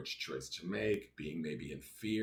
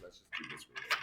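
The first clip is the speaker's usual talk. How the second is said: usual speaking voice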